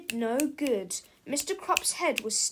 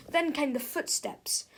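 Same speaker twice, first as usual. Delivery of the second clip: ordinary delivery, nothing marked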